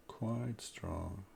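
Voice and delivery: quiet musing repeat. Real, not acted. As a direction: mutter "Quite strong" to yourself, rising at the end.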